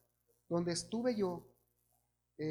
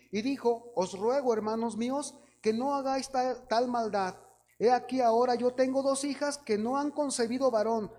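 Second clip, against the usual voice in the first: scripture reading; 2 semitones higher, 5 dB louder than usual. Acted. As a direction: proclaim the verse to the whole church. Reads Genesis aloud to congregation.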